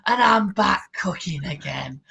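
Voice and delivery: slow, low, drawn-out Yorkshire greeting; Kay's Cooking. Retold or imitated. Podcaster mimicking a cooking YouTuber's video opening, slow smoky Yorkshire impression. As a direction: imitate a heavy smoker's Yorkshire video intro. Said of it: "she just goes".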